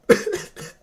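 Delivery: short high breathy burst of laughter; a real squeaky breathy laugh. Real, not acted. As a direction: cracks up at his own photo. Laughing at his own cosplay.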